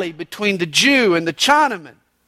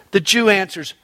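normal talking voice

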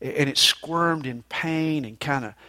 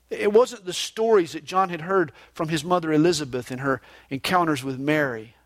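normal talking voice

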